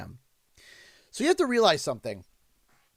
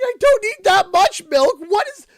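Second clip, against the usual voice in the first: pitched way up and loud, near crying in disbelief; whining that it's too much milk; exasperated high rant; 12 semitones higher, 11 dB louder than usual. Real, not acted. Vlogger complaining to chat about coffee order, high exasperated whine.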